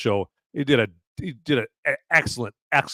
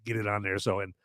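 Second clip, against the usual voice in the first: ordinary talk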